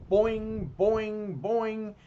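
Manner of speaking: high, springy cartoon boings; ringing "boing" for each hop; bouncing boings. A narrator's reading. Imitates bouncing away.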